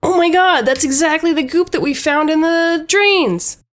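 a plumber crying out at the find; exclaiming plumber. Acted. Plumber on seeing the goop from the drains, high exclamation. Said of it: "the plumber goes".

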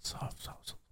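breathy whisper, soft souls. Pretend. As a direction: breathe it out like a secret. Echoing the feet pic tease.

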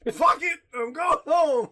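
fed-up shout, half joking; loud exasperated shout. Acted. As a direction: yell it like you're storming off. Jokingly storming off.

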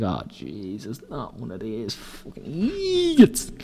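quiet, slow despairing groan; exasperated mutter. Retold or imitated. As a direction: let it drop in disbelief. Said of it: "I was like".

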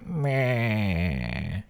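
long bleating groan sliding down. Acted. Imitating the date as she groaned, long falling groan. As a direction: drag out a wordless groggy "meh". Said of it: "exactly what she sounded like".

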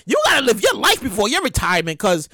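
normal talking voice

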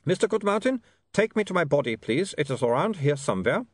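put-on high, accented vampire making a request; vampire Otto, politely asking. A narrator's reading. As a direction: ask a favour as a courteous vampire.

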